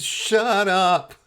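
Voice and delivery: a cousin's high-pitched plea; squeaky shut up. Retold or imitated. Cousin hushing him at funeral.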